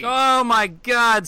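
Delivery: excited. Real, not acted. Excited entrance.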